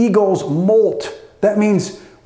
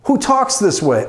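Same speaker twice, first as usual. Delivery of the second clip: usual speaking voice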